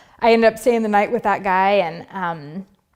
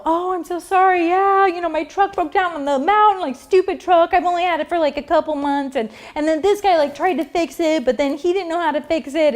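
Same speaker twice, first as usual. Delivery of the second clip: high-pitched, overdone apologetic excuse; theatrical, put-on innocence; dramatic lie; 7 semitones higher than usual. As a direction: act out a gushing fake excuse. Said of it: "went into this whole dramatic lie".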